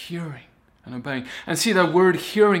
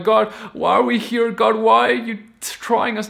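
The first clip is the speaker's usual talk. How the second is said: higher, louder cry of the complaining Israelites; frustrated Israelites; 8 semitones higher, 7 dB louder than usual. Retold or imitated. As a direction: cry out like the weary people in the desert. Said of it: "They're like".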